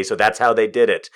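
normal talking voice

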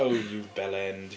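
low, slow British insult; British accent. Pretend. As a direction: drawl it like a Brit mocking a mate. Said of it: "things like that".

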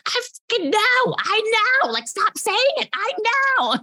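high, annoyed mock replies, insisting; exasperated. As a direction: snap back that you already know. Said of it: "I was like".

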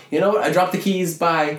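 high, loud mock panicked bailing; nervous guy quote. Keyholder squeaks as he abandons them.

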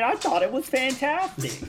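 high, overdone sarcastic praise. Acted. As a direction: praise it so it means the opposite. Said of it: "No sarcasm".

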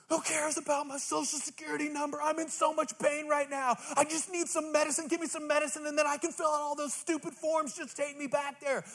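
high-pitched whiny mock rant; cranky patient complaint. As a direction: whine it up high like a grumpy patient in pain. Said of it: "my response was".

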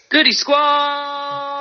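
drawn-out show intro shout; hype shout. Acted. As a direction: shout the crew name.

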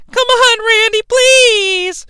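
high falsetto pleading; begging woman. Retold or imitated. Liz begging Randy.

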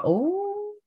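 very high, drawn-out mock-flattered coo; coy reaction. Performer recognized in a bar, high and coy. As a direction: squeal it coyly, as if recognized.

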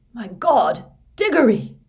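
high, loud shocked gasp of words; appalled exclamation. A narrator's reading. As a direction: cry out in horrified recognition. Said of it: "it whispered".

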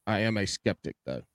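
ordinary talk

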